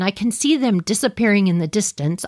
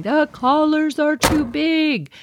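raised, slowed urgent call; retelling a yell at the family, mildly raised; mild mock yell; 7 semitones higher, slower than usual. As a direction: call it out across the driveway, not full volume. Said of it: "I'm yelling".

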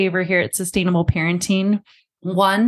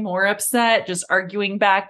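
usual speaking voice

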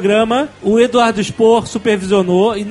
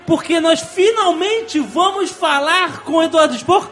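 big show-opener voice, higher and stretched; podcast announcer; 5 semitones higher, slower than usual. Acted. Host opening the show for the nerds, hyped announcer voice. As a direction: hype the audience like a radio host.

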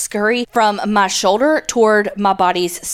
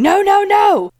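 mock panicked shout, pitched far up; manly shouting; 11 semitones higher, 4 dB louder, slower than usual. A narrator's reading. Listener's story of flying off the bed, shouting. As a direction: yell it out in high, hammed-up alarm. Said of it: "a manly voice comes out of my four eight body shouting".

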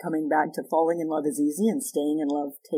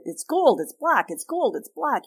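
high, squabbling back and forth; a couple stuck in a petty argument, pitched up; mock bickering; 8 semitones higher than usual. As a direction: toss it back and forth like a spat. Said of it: "We polarize into me against you".

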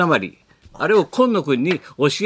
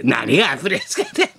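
mock scoffing; 4 semitones higher than usual. Mocks wife's use of app.

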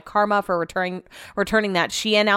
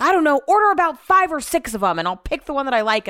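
mock-upset reply, higher pitched; Brett; 7 semitones higher, 3 dB louder than usual. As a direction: imitate him worked up and demanding, voice raised. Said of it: "he's so upset".

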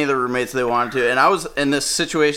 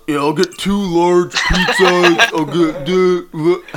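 slow, loud, drawn-out stoned voice; stoned pizza order; 4 semitones higher, 5 dB louder, much slower than usual. Retold or imitated. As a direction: drag the order out like he's high and happy. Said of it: "He becomes this guy".

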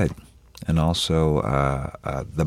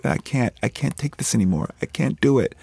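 tearful despair; 8 semitones higher than usual. Retold or imitated. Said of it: "burst into a puddle of tears".

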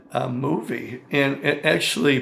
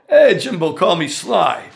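higher, louder, hammed-up tough-guy greeting; Stallone impression; 4 semitones higher, 6 dB louder than usual. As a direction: toss off a buddy-buddy Stallone hello. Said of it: "He goes".

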